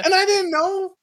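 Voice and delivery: very high, loud squeaky plea; comically high protest of innocence; mock innocent. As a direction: squeal a feigned excuse. Mock innocent excuse about sister.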